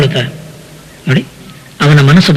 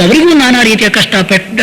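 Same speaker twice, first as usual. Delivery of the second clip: ordinary talk, nothing marked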